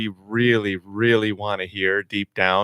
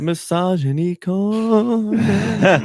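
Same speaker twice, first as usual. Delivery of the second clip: high, slow, stretched show-title voice; mock announcer; 12 semitones higher, much slower than usual. Joking friend titles the remark like a radio segment, high and slow.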